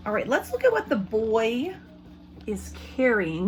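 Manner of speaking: ordinary delivery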